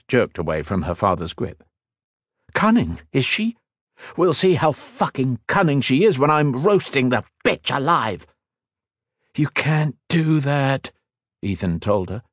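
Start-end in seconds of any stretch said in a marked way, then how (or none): 2.5-8.3 high-pitched, vengeful anger; furious threat. A narrator's reading. Woman vows revenge on the queen, furious. 9.3-10.9 high, slow, urgent objection; desperate plea. A narrator's reading. Ethan holds back his fiancée, desperate. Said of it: "desperately thrusting out a restraining arm".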